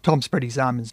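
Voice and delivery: ordinary delivery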